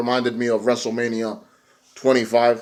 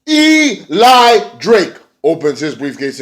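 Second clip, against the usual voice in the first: ordinary talk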